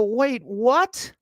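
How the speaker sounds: high, incredulous question; retold double take with a scrunched-up face; baffled disbelief. Customer hearing his package was sent back, baffled disbelief. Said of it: "I scrunch up my face".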